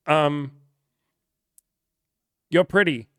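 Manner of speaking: awkward nervous guy. Retold or imitated. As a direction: blurt it out like a panicking guy. Guy nervously complimenting a stranger.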